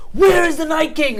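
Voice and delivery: high, loud mock battle yell; retold scream. Jon and Dany screaming mid-battle, mock desperate shout. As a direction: holler it as a hammed-up panicked demand. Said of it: "like, screaming in".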